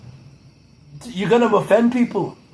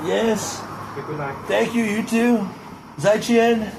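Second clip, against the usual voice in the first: Donald Trump impression; 5 semitones higher than usual. Greeting the delivery man in a Trump voice, blustering praise. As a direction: gush over the delivery guy like a politician.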